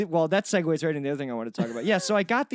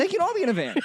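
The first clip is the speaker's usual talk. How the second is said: echoed much higher, stretched; high mock echo; 12 semitones higher, much faster than usual. Shown as a demonstration. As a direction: repeat it in a squeaky high voice. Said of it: "repeated higher".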